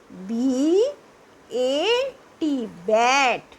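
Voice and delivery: letters drawn out one by one; slow spelling. Shown as a demonstration. Teacher spelling to pupils, slow and drawn out. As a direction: spell it slowly for the class.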